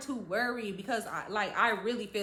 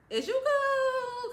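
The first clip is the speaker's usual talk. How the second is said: very high, very slow mocking whine; 13 semitones higher, 3 dB louder, much slower than usual. Narrator mocks her friend's fake concern, in a very high, slow whine. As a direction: throw her words back at her, sarcastically.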